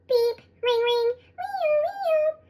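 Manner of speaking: high sing-song siren wail; high mock siren noise; siren imitation. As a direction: mimic a siren playfully, going way up. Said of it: "She laughs at her imitation of a siren".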